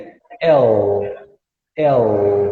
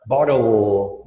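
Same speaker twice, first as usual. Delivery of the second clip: "Bottle" in an American accent, T flapped like an R; American "boddle" with an L ending; American flat T; 6 semitones lower, much slower than usual. Shown as a demonstration. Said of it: "que fica com flat T".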